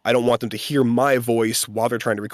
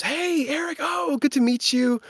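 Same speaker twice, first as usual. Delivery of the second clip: cheery director voice. Retold or imitated. Director greeting him at studio.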